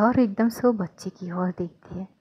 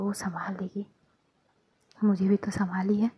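softer whispered reassurance; 3 semitones lower, 6 dB quieter than usual. Acted. Partner whispers reassurance about holding the baby. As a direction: hush it gently.

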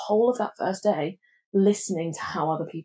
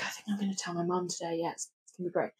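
nervous self-talk; 12 dB quieter, much faster than usual. Retold or imitated. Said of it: "I was like".

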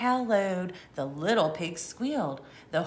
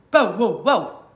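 loud barking bow wow; dog bark; 10 dB louder than usual. Acted. Reading a farm book, the dog barks loudly. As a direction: bark like a big red dog.

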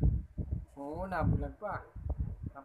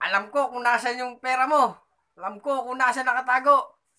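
high, louder menacing robber; 8 semitones higher, 8 dB louder than usual. Acted. Holdupper taunting the old lady, loud and high. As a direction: taunt her like a thug who knows. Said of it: "Sabi naman ng holdupper".

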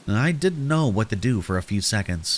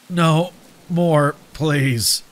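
higher, halting plea in pain; dying person pleading; 5 semitones higher, 3 dB louder, much slower than usual. Acted. Mutilated person begs the narrator, pained and pleading. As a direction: beg as if badly wounded.

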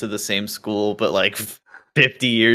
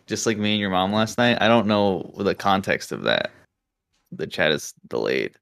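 usual speaking voice

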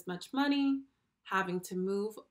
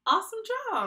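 excited praise; 11 semitones higher, 6 dB louder, much faster than usual. Acted. Teacher praising correct answer.

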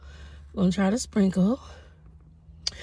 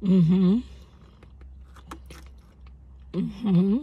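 talking with food in mouth; muffled speech between bites; chewing; 3 semitones higher, slower than usual. Real, not acted. Seen 2: Bites donut before, holds it and chews while murmuring.

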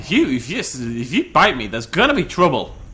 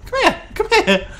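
much higher quick taunting calls; gleeful chase calls. Real, not acted. Chasing the T-Rex, much higher gleeful calls. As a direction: call after him like a hunter.

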